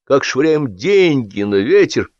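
pathetic. A narrator's reading. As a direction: declaim it with hammed-up pathos. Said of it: "патетично проговорил Кирилл Иванович".

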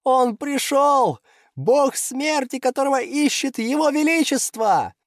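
theatrical elated wail; rapturous. Acted. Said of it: "вдруг восторженно заулыбавшись".

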